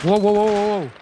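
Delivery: hoch und laut jubelnd; aufgeregter Jubel. Real, not acted. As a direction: begeistert losschreien. Sieg im Spiel, Jubel. (Wow-Rufe voller Begeisterung)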